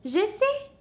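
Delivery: high, bright-eyed "I know!"; eager exclamation. A narrator's reading. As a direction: burst out with the idea. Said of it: "s'écrie Plume".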